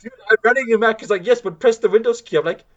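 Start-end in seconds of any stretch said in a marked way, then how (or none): 0.0-1.0 high, fast and loud in disbelief; exasperated. Retold or imitated. Retelling his reply to the scammer. 1.3-2.4 high, loud, pushy insistence; insistent scammer. Retold or imitated.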